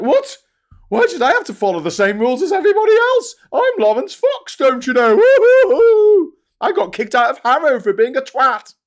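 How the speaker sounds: high, loud, sneering posh-boy mockery; mocking posh toff. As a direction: lampoon an entitled public-school toff, shrill and loud. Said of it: "They're like".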